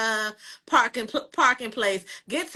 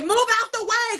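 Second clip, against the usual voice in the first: mock yell; 11 semitones higher, 3 dB louder than usual. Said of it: "I'm like".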